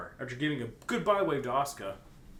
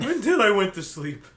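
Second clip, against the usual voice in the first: loud, higher mocking repeat; 7 semitones higher, 12 dB louder than usual. Friend mocks Jotaro's line to the narrator, loud. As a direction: parrot the line back, teasing.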